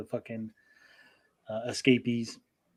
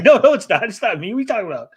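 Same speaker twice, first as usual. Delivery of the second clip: panicked denial; 8 semitones higher, 9 dB louder, faster than usual. Retold or imitated. Accused man denies being the mole.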